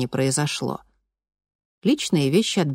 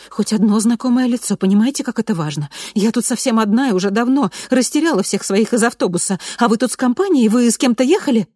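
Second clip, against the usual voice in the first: rapid pleading chatter; 7 semitones higher, 5 dB louder than usual. A narrator's reading. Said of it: "затараторила женщина".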